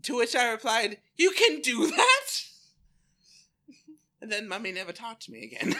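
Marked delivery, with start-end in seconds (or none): 1.2-2.3 put-on high, loud astonished kid; mock childlike astonishment, higher and louder; surprised child. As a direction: gasp it out like an amazed child. Child self in retold story.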